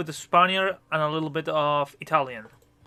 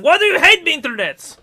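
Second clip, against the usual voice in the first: mock outrage; 11 semitones higher, 11 dB louder than usual. Music stream broken, mock complaint.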